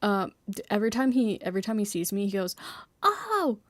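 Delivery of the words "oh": high gasp of surprise. Retold or imitated. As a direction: gasp like you are delighted to see them. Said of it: "he gasps and says my name".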